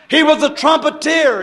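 ordinary talk, nothing marked

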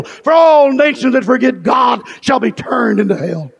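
fervent, driving revival sermon; fiery pulpit proclamation; full-force preaching. Real, not acted.

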